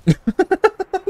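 very high rapid giggle; high-pitched burst of laughter; gleeful cackle. Real, not acted. Cackles at squashed enemy.

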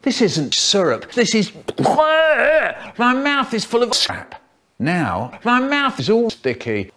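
ordinary talk, nothing marked

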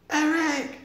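high, loud shout of the name. Acted. Sid shouting down to the fallen boy. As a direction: call his name in alarm. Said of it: "shouted Sid from the truck".

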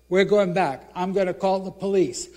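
firm threat. Retold or imitated. Threatening thief.

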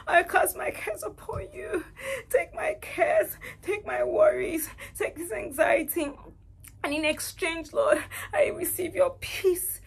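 high, loud, crying prayer; tearful prayer. Retold or imitated. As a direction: plead with God through sobs, building then easing. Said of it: "started saying".

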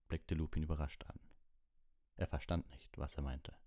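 usual speaking voice

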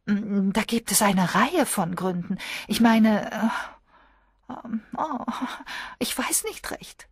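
high, faltering and fading to breath; lightly voiced flustered young woman; hesitant girl. A narrator's reading. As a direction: lose the thread mid-sentence. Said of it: "meinte Miss Hellier".

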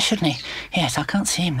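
ordinary delivery

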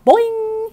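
very high twangy boing, like a snapped guitar string; frog boing. A narrator's reading. Frog leaping at the hamster, a loud boing. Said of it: "He sounded like a broken guitar string".

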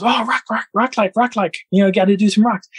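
put-on high, eager child voice; excited little boy. Little boy hearing rock clacks, excited. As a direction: imitate a boy thrilled by a discovery. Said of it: "the little boy goes".

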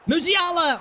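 high, loud name shout; excited shout. Real, not acted. Shot from the edge.

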